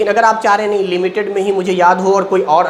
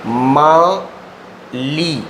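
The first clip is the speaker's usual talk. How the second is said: माली very slow, split as "मा ... ली"; drawn-out syllables; 4 semitones lower, much slower than usual. Shown as a demonstration. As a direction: stretch Mali, pausing between its two syllables. Writes out the code word slowly. (माली को धीरे-धीरे, तोड़कर बोलें)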